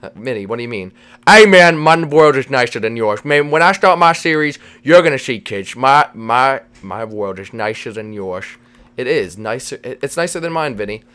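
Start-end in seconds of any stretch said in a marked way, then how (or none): 1.3-8.4 emphatic bragging with slushy s sounds; boastful cartoon buddy. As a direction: brag in a slurred goofball voice. Vinny boasts about his world.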